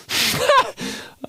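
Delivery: shrill burst of laughter; high squeal laugh. Real, not acted. Laughing at own joke.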